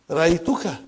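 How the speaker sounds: usual speaking voice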